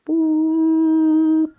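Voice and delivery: high, long hooting boop like a blown conch; imitated conch boop. Imitating her conch to call the whales, long high boop. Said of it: "do this big boop".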